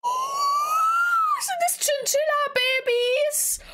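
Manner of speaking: high, slow excited shout; excited squeal. Real, not acted. Sees chinchilla babies. (hoher, begeisterter Aufschrei)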